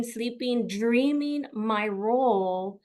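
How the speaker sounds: ordinary delivery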